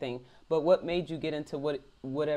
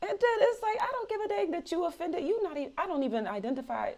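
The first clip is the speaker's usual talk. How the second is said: worked-up rant; 13 semitones higher than usual. Real, not acted. Ranting to the host about the sergeant, worked up and high. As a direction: voice shooting way higher.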